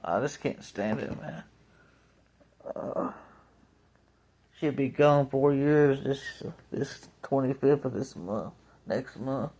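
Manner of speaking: quiet, choked-up voice, close to tears; getting emotional. Real, not acted. Grieving his grandmother, voice breaking, softly choked up. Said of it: "it's getting me emotional now".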